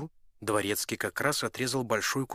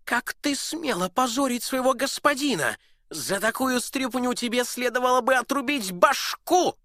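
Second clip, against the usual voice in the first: high, loud raging duke; furious duke; 7 dB louder than usual. Acted. Duke raging at his cook, high and loud. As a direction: threaten to chop his head off.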